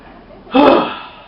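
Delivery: loud breathy exhale; big sigh. Real, not acted. Storyteller finishes recap, big sigh.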